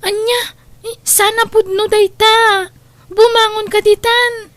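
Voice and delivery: crying. Acted. Breaks down hearing of kidnapping.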